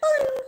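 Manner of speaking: high imitated "boing"; very high cartoon launch sound; flinging noise. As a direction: fling it away with a squeaky boing. Imitates flinging the snake away.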